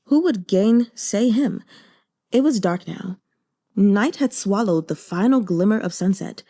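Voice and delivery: usual speaking voice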